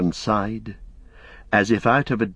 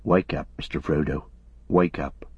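soft and low, gently coaxing; softly; 5 semitones lower, 3 dB quieter than usual. A narrator's reading. Sam gently waking sleeping Frodo, soft and low. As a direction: wake him tenderly. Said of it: "spoke softly to him".

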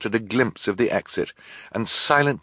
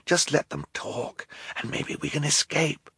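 softer hushed voice; 7 semitones higher, 6 dB quieter than usual. Acted. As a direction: Klaus whispering to his sisters. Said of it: "he whispered".

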